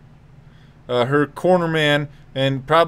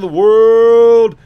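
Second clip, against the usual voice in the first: loud, slow, stretched ring call; Bruce Buffer call; 5 semitones higher, 8 dB louder, much slower than usual. Retold or imitated. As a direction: boom the name out long. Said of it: "Bruce Buffer has the microphone".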